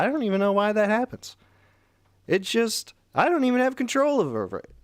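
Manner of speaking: suddenly chummy psycho, pitch climbing high; very friendly. Retold or imitated. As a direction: imitate a man confiding sheepishly, voice rising. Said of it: "Immediately very friendly".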